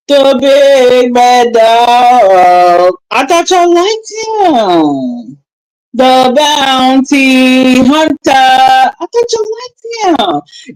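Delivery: slow, theatrical sing-song; dramatic mock lament. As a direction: stretch it like a sorrowful ballad.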